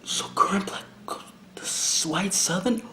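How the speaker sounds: hushed whisper, under the breath; soft aside whisper. Real, not acted. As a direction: murmur it to yourself as you read along. Whispering while reading clip text.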